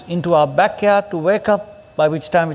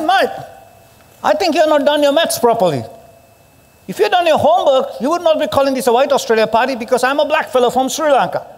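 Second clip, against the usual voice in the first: retold retort; 7 semitones higher than usual. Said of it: "I said".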